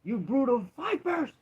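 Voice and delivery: a huge high shout of rebuke; furious shout. Acted. Jesus rebuking the crowd, a furious high shout. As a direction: bellow it out like a prophet condemning them.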